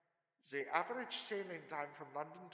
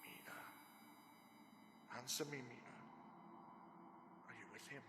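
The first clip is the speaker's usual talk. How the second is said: soft, low coaxing; hypnotist's soft probing; soft hypnotic questioning; 5 semitones lower, 11 dB quieter than usual. Acted. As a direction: draw her out gently under trance. Hypnotist questioning entranced Mina.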